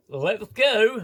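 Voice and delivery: high, loud mock command; hammed-up captain launching the ship; captain's catchphrase. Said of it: "this captain goes".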